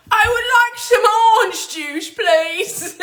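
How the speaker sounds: ape a proper English woman ordering juice; posh English accent. Retold or imitated. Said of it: "it just seemed very, like, proper".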